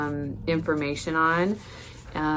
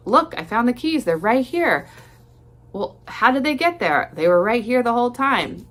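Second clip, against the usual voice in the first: higher and louder, falsely cheerful; mock triumphant partner; 8 semitones higher, 5 dB louder than usual. Partner pretending the keys were always there, mock innocent and loud. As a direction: act like you just found them, all innocence. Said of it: "they come back at you like".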